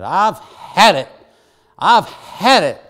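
loud, slow, fed-up yell; retold scream. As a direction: bellow it out, slow and exasperated. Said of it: "I screamed and I told 'em".

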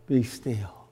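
preacher wishing for calm, hushed; quiet command. Acted. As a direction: say it gently, almost to yourself. Wishful retelling of Jesus' words.

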